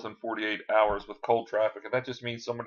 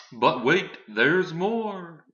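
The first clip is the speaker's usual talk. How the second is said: higher, drawn-out TV pitchman; hammed-up infomercial pitch, swooping up high; 6 semitones higher, 5 dB louder, much slower than usual. Presenter pitching a system to viewers, mock infomercial voice. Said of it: "best infomercial voice".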